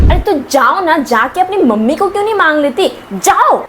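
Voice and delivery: irritated dismissal. Acted. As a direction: shoo the child off crossly. Irritated sister sends child away.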